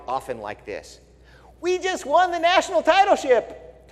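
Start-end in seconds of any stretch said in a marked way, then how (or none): 1.6-3.9 high, louder mock fan crowing. Pastor mocks celebrating fans, high mock boast. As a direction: crow like a dumb sports fan.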